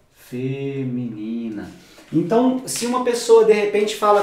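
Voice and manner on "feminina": Feminina said low and slow; low drawn-out word. Shown as a demonstration. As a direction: let Feminina sink and stretch out. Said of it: "voz feminina". (Feminina dita grave e lenta)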